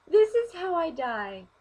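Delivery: high, loud, slow mock despair; dramatic wail. To herself on fire, mock wailing. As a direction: wail it as if doomed. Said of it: "and I'm like".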